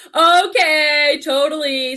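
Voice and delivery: laughing through "I'm finding Erez" into a high giggle; amused giggle at her own accent. Real, not acted. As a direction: crack up at your own pronunciation.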